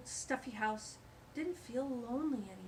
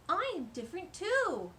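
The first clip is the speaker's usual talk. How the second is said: louder, higher, proud declaration; brave badger; 8 semitones higher, 6 dB louder than usual. Badger Pumpernickel standing up to the stink bug, proud and bold. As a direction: stand up and speak out. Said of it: "he said".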